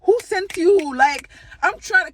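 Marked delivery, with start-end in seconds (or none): 0.0-1.0 higher-pitched mock African accent; playful African accent. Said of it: "And I put in my accent".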